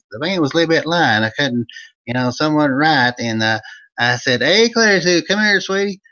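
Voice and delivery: high, loud hillbilly storyteller from Eastern Kentucky; Old Vern character voice. Acted. Host greeting his viewers as Old Vern, hammed-up high folksy voice. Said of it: "it's the Old Vern Show".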